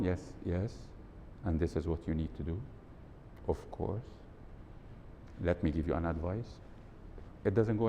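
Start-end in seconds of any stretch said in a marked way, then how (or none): 0.0-6.6 flat, softer, rushed advice; monotone coach. Retold or imitated. A dull coach advising a client by phone: flat monotone. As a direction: read the advice with no life in it. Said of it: "and then they are monotone".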